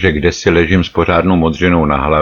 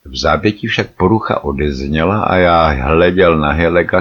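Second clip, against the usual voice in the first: usual speaking voice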